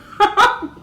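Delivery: a high, loud burst of laughter; big laugh. Real, not acted. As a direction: laugh out loud at your own joke. Laughing at her own joke.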